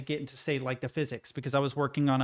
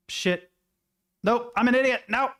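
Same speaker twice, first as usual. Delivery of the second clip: frustrated outburst; 8 semitones higher, 4 dB louder than usual. Real, not acted. Self-scolding after a mistake.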